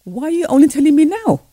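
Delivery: sharp astonished demand; indignant surprise. Retold or imitated. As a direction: catch the teacher out. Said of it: "So I said".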